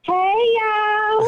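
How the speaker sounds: high, drawn-out country "hey, y'all"; country-accent greeting. Shown as a demonstration. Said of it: "Say, "Hey, y'all," one more time".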